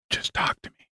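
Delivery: whispered request, no voice; unvoiced sultry plea; breathy whisper. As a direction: whisper it close and intimate. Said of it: "she'll be like".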